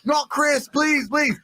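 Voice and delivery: slow, high panicked begging; panicked plea. Retold or imitated. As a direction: drag out the plea in a frantic high voice. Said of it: "like, panicking".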